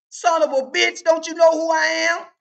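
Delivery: yelling at the bartender; angry shout. Acted. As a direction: bark it out furiously. Stack yells at bartender.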